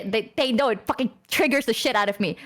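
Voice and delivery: ordinary talk, nothing marked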